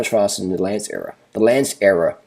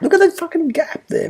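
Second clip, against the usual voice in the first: amazed excitement. Real, not acted. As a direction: voice leaping very high in amazement. Marvelling at rider's huge gap.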